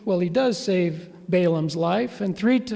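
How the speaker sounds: ordinary talk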